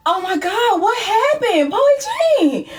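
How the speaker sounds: high, anxious calling out what happened; alarmed high concern. Retold or imitated. Roommate begging crying friend to explain, high and alarmed. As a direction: plead high and worried for an answer. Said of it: "I'm like".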